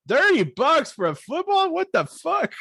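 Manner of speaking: high, incredulous yelp at the price; shocked disbelief. Retold or imitated. Friend recalls watching the episode, shocked high squeal. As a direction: gape at the thirty-dollar football. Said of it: "I was like".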